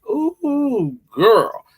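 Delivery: put-on a high, stretched-out ooh, girl; exaggerated ooh of delight; high drawn-out ooh. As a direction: swoop way up on the ooh, then land on girl. Drawn-out exclamation.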